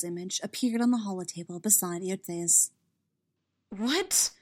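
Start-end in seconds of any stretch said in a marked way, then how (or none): 3.7-4.3 surprised. Acted. Commodore surprised.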